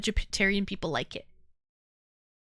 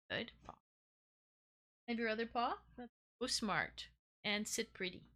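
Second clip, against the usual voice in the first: soft dog commands; 12 dB quieter, faster than usual. Real, not acted. Giving dog commands softly.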